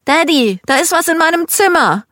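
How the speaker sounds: high, loud cry for Daddy; very high, alarmed child's shout; frightened child. A narrator's reading. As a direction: call out as a scared kid. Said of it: "bekam es mit der Angst zu tun".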